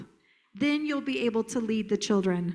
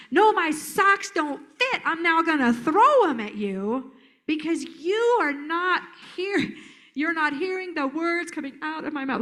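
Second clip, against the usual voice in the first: put-on escalating kid; 3 semitones higher than usual. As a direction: mimic an exasperated kid hammering each word. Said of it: "they're gonna escalate".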